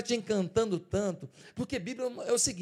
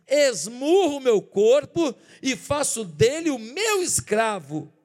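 slow, loud, emphatic reading; forceful scripture quote; 3 semitones higher, 9 dB louder, slower than usual. Acted. Preacher declares Paul's words to the congregation, slow and loud. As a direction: pound out each word like a blow.

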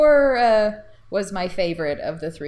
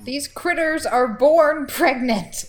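Bones; 7 semitones higher, 4 dB louder, slower than usual. Retold or imitated.

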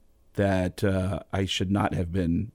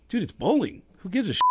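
high, offhand scoff; mock dismissive scoff; 9 semitones higher than usual. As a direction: brush the worry off. Dismissive retort retold.

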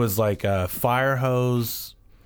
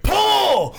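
high yelled "Pull!"; shout; 6 dB louder than usual. Retold or imitated. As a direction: bark it like calling for a clay target. Acting out shooting with fire hose.

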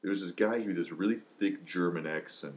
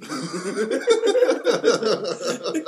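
bursting into high loud laughter; a big real laugh at the dark punchline; 11 dB louder than usual. Real, not acted. Cracking up after telling the Holocaust joke, loud high laughter.

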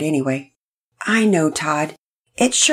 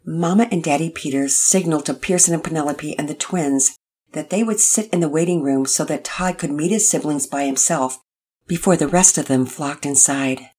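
ordinary delivery

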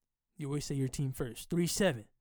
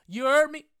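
loud high yelled repeat; shouted hype; 11 semitones higher, 11 dB louder than usual. Acted. Podcaster hyping his listeners, loud high yell.

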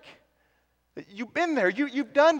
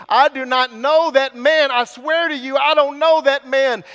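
loud, higher-pitched yell of denial; 4 semitones higher, 7 dB louder than usual. Acted. Preacher voicing Peter denying Jesus, yelled. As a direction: yell it out, insisting under oath. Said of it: "now you are yelling and you're saying".

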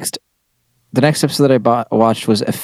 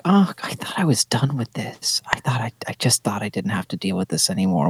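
breathy unvoiced whisper; 7 dB quieter than usual. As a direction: whisper it like a weary aside. Retold reaction, whispered aside.

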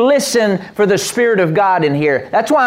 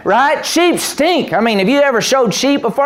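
ordinary talk, nothing marked

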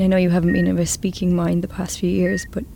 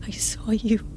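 whisper; 10 dB quieter than usual. Acted.

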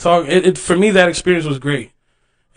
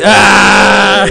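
loud high roar; wrestler's war cry; 3 semitones higher, 7 dB louder than usual. Pretend. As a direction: let out a big wrestling yell. End of promo, shouts.

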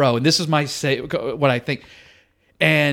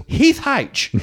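raised-pitch stage introduction; emcee introduction; 9 semitones higher than usual. Retold or imitated. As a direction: announce the next act up high, as an emcee. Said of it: "So then he goes".

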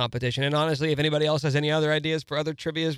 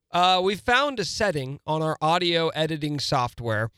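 usual speaking voice

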